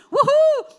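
high, loud woohoo; excited cheer. Real, not acted. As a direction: whoop to fire up the crowd. Cheering the congregation.

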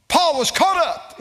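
high, loud burst of proclamation; sudden exclaimed preacher's cry; preaching shout. Real, not acted. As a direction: fire out the example at full force. Shouts scriptural example to congregation.

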